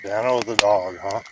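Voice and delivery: put-on telling a bedtime story to a sleepy child; bedtime story narration. A narrator's reading. Dad reading a bedtime story to his son, animated storytelling.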